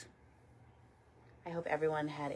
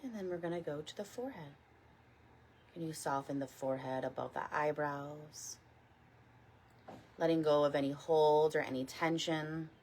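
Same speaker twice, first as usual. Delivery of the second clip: guided meditation. Real, not acted. Teacher leading a morning body scan, calm guided meditation. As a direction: guide them gently, unhurried, letting each phrase settle.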